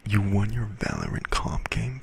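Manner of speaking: whispered question. Real, not acted. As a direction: whisper it impressed. Whispered reply to chat.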